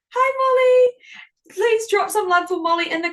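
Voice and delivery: high, loud, bright call of hello; excited greeting. Real, not acted. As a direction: call out hi like spotting a friend. Greeting scientist joining live.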